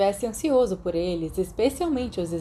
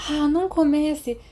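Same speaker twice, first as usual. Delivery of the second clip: higher, slower exasperated huff; snorting huff; 7 semitones higher than usual. A narrator's reading. Peter grumbling at Sirius trailing behind, huffy. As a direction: brush off the old argument. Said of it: "Peter bufou".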